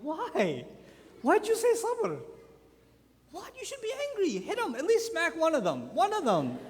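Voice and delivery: high-pitched, exasperated disbelief; incredulous protest. Acted. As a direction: protest as if you cannot believe his restraint. Acted protest at the father's patience.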